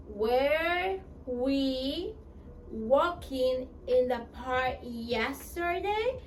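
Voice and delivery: slow "Were we walking in the park yesterday?" rising at the end; question rise. Shown as a demonstration. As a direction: ask it slowly, lifting the end. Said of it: "Question mark". (pregunta lenta con entonación ascendente)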